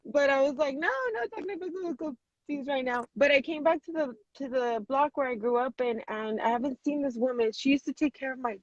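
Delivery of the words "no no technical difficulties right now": high, softer mock whine; playful protest. Real, not acted. To the host after the call froze, playful protest.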